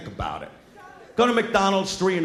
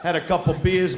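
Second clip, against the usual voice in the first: ordinary talk, nothing marked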